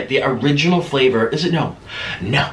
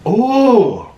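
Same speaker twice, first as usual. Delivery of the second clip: delighted ooh; 8 semitones higher, 5 dB louder than usual. Real, not acted. Tasting spicy honey mustard.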